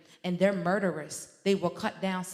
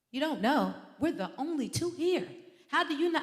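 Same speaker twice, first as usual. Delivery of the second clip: exasperated scolding; 7 semitones higher than usual. Retold or imitated. Mother confronting her son about the lights, rising indignation. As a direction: let the outrage climb with each question.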